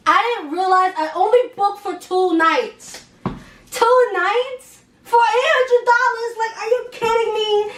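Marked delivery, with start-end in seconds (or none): 3.2-7.7 outraged disbelief. Real, not acted. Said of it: "outraged at hotel price".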